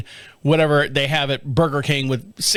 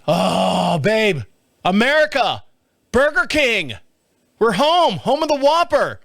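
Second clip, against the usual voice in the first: rising higher and higher with glee; building excitement over Burger King, up to a joyful peak; thrilled; 6 semitones higher, slower than usual. Retold or imitated. Husband to wife, spotting fast food after a long flight, rising excitement.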